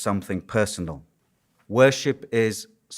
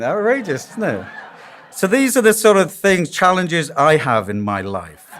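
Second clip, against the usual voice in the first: ordinary talk, nothing marked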